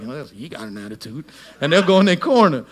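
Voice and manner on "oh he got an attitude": muttered aside. Retold or imitated. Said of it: "they'll be like".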